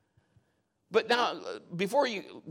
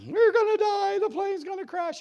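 put-on high, loud drunk panic; panicked yelling; 5 dB louder than usual. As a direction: imitate a man losing it mid-flight. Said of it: "He's yelling, he's losing it".

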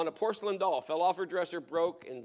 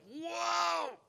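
high, amazed group cry of whoa; a startled, amazed shout. Retold or imitated. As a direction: shout it like stunned kids watching a miracle. Kids react to deliverance.

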